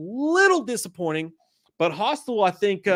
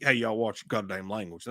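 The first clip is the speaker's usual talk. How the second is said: stern cowboy; 7 semitones lower, 6 dB quieter than usual. Retold or imitated. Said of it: "He's like".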